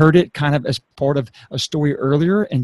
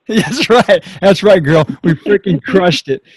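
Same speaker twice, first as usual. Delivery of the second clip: loud, high, laughing cheer; elated celebration; 4 semitones higher, 6 dB louder than usual. Real, not acted. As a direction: celebrate with laughter breaking through. Host celebrates guest's completed breakdown.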